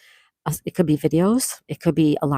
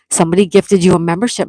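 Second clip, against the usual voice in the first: louder, rushed excited telling; excited and fast; 7 dB louder, faster than usual. Real, not acted. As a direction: blurt out the good news. Explaining gift to viewer.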